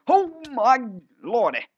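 high, loud startled cry; excited shriek. Real, not acted. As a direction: shriek in delighted shock. Sudden event in game.

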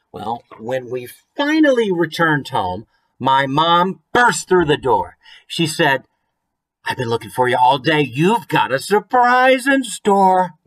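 picture-book reading with a lilt; rhyming storybook reading. A narrator's reading. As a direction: read the rhyme aloud to the kids.

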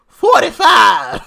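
loud, slow drawn-out yell of the slogan; mock Maxine shout. Vlogger mocks her voice again, shouting forty-five. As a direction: bellow it like a rally chant. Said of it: "Her voice is just so terrible".

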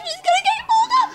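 very high, piercing, fast shriek; excited scream. Real, not acted. Watching a friend nearly get caught, excited scream. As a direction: scream it in a rush of game panic.

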